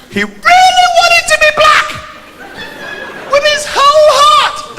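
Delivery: very high, slow squeaky exaggeration; hammed-up falsetto for his wannabe pal; high, slow mock-passionate voice; mock earnest. Mocking earnest wish.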